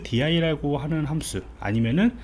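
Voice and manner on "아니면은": normal talking voice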